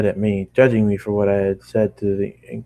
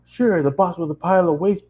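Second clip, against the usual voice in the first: bitter aside; 9 semitones higher than usual. Acted. Angry rant at the girl.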